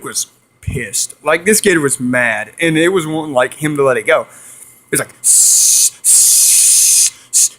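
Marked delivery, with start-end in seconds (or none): 5.2-7.6 long, forceful imitated hisses; turtle hissing. Turtle wanting him to let it go, hissing. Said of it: "It was hissing".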